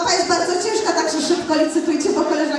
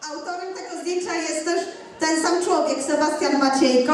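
ordinary talk

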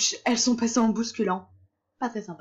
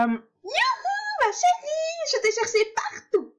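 fairy godmother voice; much slower than usual. Acted. Voicing the flamboyant godmother aunt.

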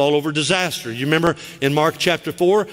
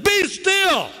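commanding shout. Retold or imitated. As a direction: order the sea to stop. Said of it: "He looks at the water and said".

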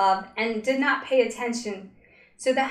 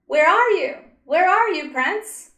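loud, high calling out; yelling; 6 semitones higher, 6 dB louder than usual. A narrator's reading. Girl searching the pool for her prince, yelling out. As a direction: call out searching for him. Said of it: "she began to yell".

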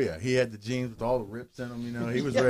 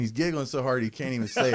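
usual speaking voice

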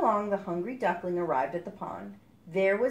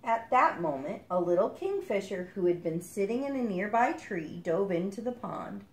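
usual speaking voice

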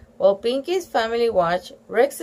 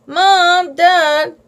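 calling out; 8 semitones higher, 8 dB louder than usual. A narrator's reading. Child calling parents.

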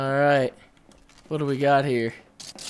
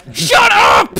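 shout; 9 semitones higher, 4 dB louder than usual. Real, not acted. Yelling at noisy players in game to shut up. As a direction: yell over the chatter.

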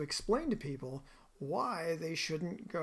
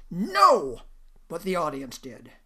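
much louder, emphatic "No" as the boss; loud retort; 14 dB louder, slower than usual. Retold or imitated. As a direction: bark the refusal, then land the punchline. Furious boss's punchline retort.

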